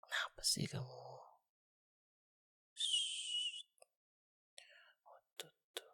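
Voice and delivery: soft unvoiced whisper; gentle whispered concern. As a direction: hush it so mama can't hear. Comforting the crying listener.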